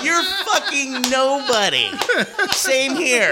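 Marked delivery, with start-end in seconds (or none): 0.0-1.9 high, slow, laughing put-down; repeating the insult while cracking up; amused mock yell; laughing retelling. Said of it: "I go".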